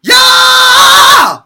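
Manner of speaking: high loud belt with vocal fry. Shown as a demonstration. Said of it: "adding in a little bit of the vocal fry".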